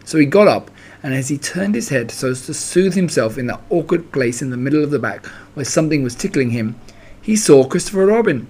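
plain reading voice

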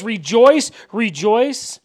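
loud, ringing call to rejoice; joyful gusto. Acted. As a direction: proclaim it with gusto to the whole congregation. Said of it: "sing it with the gusto".

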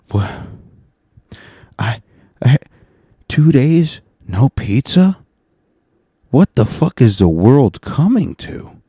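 loud, high, incredulous outburst; outraged disbelief. Acted. Host to listeners, outraged at two pizza-free days, loud and high. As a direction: act scandalized that there's no pizza.

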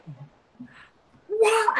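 high-pitched shrieked disbelief; retold scream. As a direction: reenact a shocked scream. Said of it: "I screamed".

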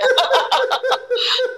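high-pitched burst of real laughter; gargalhada. Real, not acted. Ri da própria fala sobre oração. (gargalhada aguda)

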